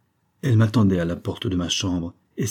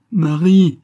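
anguished cry, much higher and louder; 9 semitones higher, 9 dB louder than usual. A narrator's reading. Grieving father cries out his dead wife's name, loud and high. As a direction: wail her name in despair. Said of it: "criait-il".

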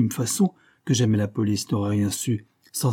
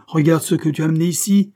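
loud, high tirade; indignant boss with raised voice; angry scolding; 6 semitones higher, 7 dB louder than usual. A narrator's reading. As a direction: rail at him in exasperation. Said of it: "grogna l'adjoint".

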